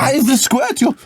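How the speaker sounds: put-on high Dutch-accented drunk voice; hammed-up drunk Dutchman begging for cream. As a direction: Dutch accent, pleading and tipsy. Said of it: "A drunk Dutchman".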